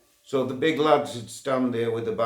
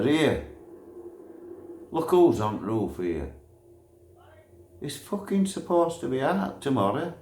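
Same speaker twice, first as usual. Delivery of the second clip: surprised exclaim; 6 semitones higher than usual. Retold or imitated. Retold remark to brother.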